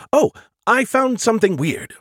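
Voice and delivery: puzzled AI, pitched right up; distracted AI character. Skippy reporting something weird to Joe, distracted.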